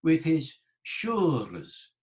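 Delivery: high, drawn-out "showers" as SHOO-res; Middle English pronunciation. Shown as a demonstration. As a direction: slowly model "showers" with long OO and rolled-in R. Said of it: "Chaucer's accent".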